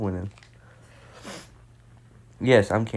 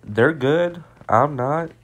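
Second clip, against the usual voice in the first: frustrated; 5 semitones higher, 6 dB louder, slower than usual. Real, not acted. Frustrated rant after dying.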